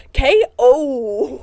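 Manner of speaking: loud fight announcer call; announcer shout. Retold or imitated. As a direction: boom it out like a ring announcer. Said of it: "All I heard in my head".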